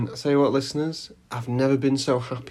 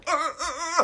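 high, soft stuck noises; speechless stammer; 5 dB quieter than usual. Retold or imitated. Pedestrian in the headlights with no words, high stammer. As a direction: mimic being frozen, squeaking out syllables. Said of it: "I didn't know what to say".

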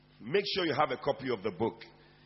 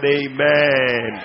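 loud call for amen; shouted amen; 14 dB louder than usual. Acted. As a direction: shout it out to the crowd. Calling congregation to respond.

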